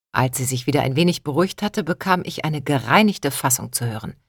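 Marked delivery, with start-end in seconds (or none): none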